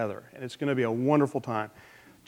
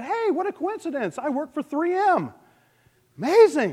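much higher, delighted father voice; amazed father; 12 semitones higher than usual. Acted. New father tells the waiting room where he works, high and amazed. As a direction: squeal out the coincidence in a high voice. Joke: second father replies.

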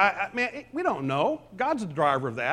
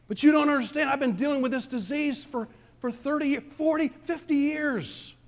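complaining sufferer; 9 semitones higher than usual. Retold or imitated. Objecting sufferer voiced by preacher.

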